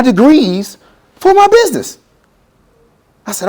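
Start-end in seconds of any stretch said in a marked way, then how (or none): none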